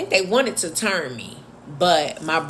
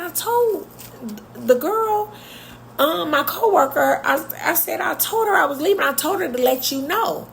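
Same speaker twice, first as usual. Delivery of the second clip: defensive explaining; 10 semitones higher than usual. Retold or imitated. Retelling her defensive explanation.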